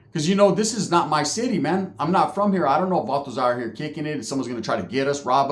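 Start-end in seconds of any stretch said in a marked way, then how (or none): none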